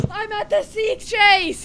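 high, slow, thrilled announcement; excited. Real, not acted. Excited arrival at the chase.

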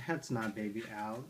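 ordinary talk

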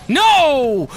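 loud, high cry of no; dismayed yell. Real, not acted. Gamer seeing a blunder, loud dismayed yell. Mistake in the fight.

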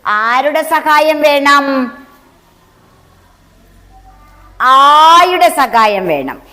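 slow, drawn-out classroom call and answer; slow teaching voice. Shown as a demonstration. Teacher slowly drawing the answer from the children. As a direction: draw the words out slowly for the class. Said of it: "teacher question and answer".

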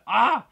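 a loud high shriek of delight; excited shriek. Real, not acted. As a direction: shriek with real joy. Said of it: "freaking out so much".

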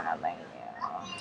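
very low, slow put-on voice; comic deep voice. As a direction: drop way down into a silly deep voice.

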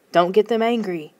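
usual speaking voice

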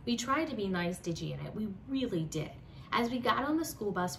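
ordinary delivery, nothing marked

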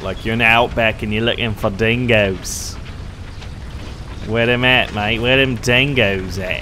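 hammed-up Aussie outback voice; mock Australian dingo hunter; bad Australian accent. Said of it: "use your worst Australian accent".

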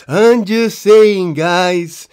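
slow, drawn-out mimicking; mock defensive. Captain C defends himself to the class, mocking drawl. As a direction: mimic a feeble excuse, stretching the words.

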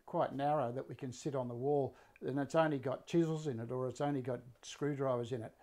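normal talking voice

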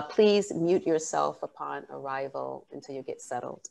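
ordinary talk

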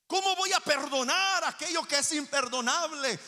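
high, impassioned rhetorical question; forceful preaching. Real, not acted. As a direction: throw the question out to the congregation.

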